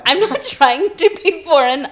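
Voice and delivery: usual speaking voice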